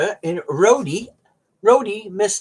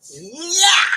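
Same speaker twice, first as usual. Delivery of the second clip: gleeful cheer; 8 semitones higher than usual. Pretend. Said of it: "I'm gonna be here going".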